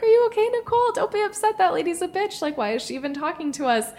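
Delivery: put-on high, supportive girlfriend voice; comforting coworkers. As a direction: reassure her, pitched high.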